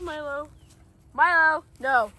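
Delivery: ordinary talk, nothing marked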